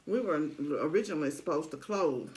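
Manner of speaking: eating while speaking; talking while chewing. Real, not acted. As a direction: mouth partly full of food. Seen 1: Puts taco piece in mouth at 2.5s, then talks while chewing.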